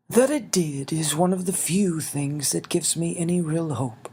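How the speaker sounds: put-on a small old man asking kindly; little winged man. Xavier's voice.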